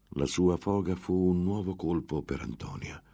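plain reading voice

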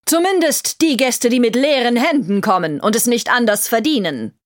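high-pitched haughty woman's voice; cold queen. Acted. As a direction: look down on him with every word. Queen's cutting retort.

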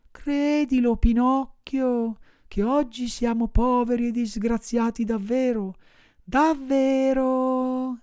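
high, slow, pitiful whine; whining voice. Acted. Fox begging Pinocchio for charity, high whining. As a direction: wheedle like a beggar fox. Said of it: "con voce di piagnisteo".

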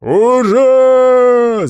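loud high battle cry; roaring cheer. Acted. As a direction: roar it out in triumph. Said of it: "заревел рыжебородый Грейв".

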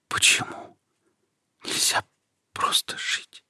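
faint, fading breathy whisper; dying whisper. A narrator's reading. Dying Nika whispers her last question, fading whisper. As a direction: let the life drain from the words. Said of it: "прошептала Ника".

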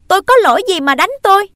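very high, loud and fast; boy protests being beaten, shrill and loud; indignant cry. Acted. Boy flees being hit.

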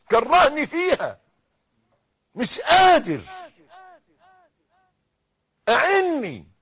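loud, high-pitched desperate plea to God; preacher shouting a sinner's prayer; impassioned preaching. Acted. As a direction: cry out for help at full force.

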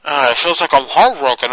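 ordinary talk, nothing marked

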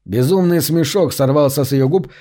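usual speaking voice

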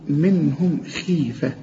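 خيفة with a barely heavy kha under kasra; lowest heaviness on khi. Shown as a demonstration. Teacher correcting tajweed: light kha in خيفة. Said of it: "في آخر درجات التفخيم".

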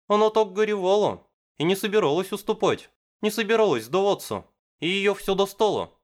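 high, insistent fan; excited girl voice. Acted. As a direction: push the point eagerly. Misaki insisting on plot.

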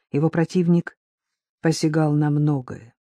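usual speaking voice

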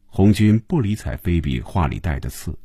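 usual speaking voice

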